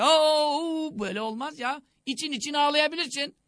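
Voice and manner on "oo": drawn-out wailing "oo"; demonstrated noisy lament, pitched up; mock loud wailing. Shown as a demonstration. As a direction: imitate someone wailing out loud. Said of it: "Sesli ağlamak, sesli".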